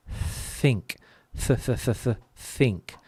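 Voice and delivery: th repeated alone between two takes of think; isolated English th. Shown as a demonstration. Teacher compares with English, th repeated in think. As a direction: softly sound out the th that starts think. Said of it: "The th is exactly the same sound".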